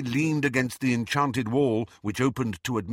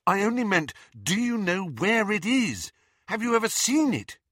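higher, urgent questioning; insistent Harry; 9 semitones higher than usual. A narrator's reading. Harry presses the charms master for where it is, insistent. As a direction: press for an answer, voice lifted.

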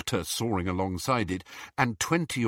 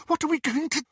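high, squeaky question cut short; anxious high-pitched voice; squeak; faster than usual. Acted. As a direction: squeak it out in alarm. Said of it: "squeaked Hermione".

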